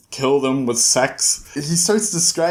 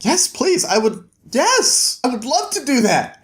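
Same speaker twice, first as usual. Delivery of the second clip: much higher, eager and delighted yes; lightly voiced eager stranger, slow and earnest; eager Tenpei; 9 semitones higher than usual. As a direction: gush with overflowing gratitude. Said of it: "Tenpei's like".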